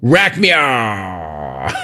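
slow, fading out into a long groan; mock passing out mid-sentence; patient going under. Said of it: "count to ten".

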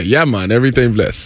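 low, relaxed Jamaican patois; Jamaican accent. Shown as a demonstration. Coaching the host before an island trip, Jamaican accent. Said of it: "That's what you say".

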